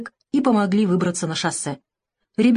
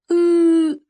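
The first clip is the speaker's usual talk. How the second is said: put-on high, loud child's bawl; long whining sob of a small boy; tearful wail; 11 semitones higher, 5 dB louder than usual. A narrator's reading. Boy bursts out crying to the doctor, wailing. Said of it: "сквозь слёзы".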